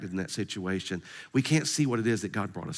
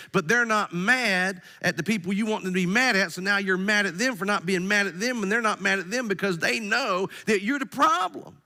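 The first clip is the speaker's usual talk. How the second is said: fired-up preaching; 7 semitones higher than usual. Real, not acted. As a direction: drive the point home to the congregation. Preaching at full force.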